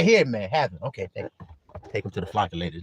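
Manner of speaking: normal talking voice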